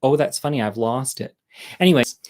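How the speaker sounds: usual speaking voice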